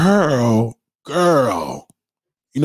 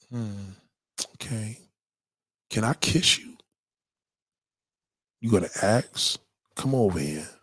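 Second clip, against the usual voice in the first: softer, lower, inviting murmur; soft come-on; 3 semitones lower, 7 dB quieter than usual. Acted. Woman on the date inviting a kiss, soft and low. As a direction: draw him in quietly.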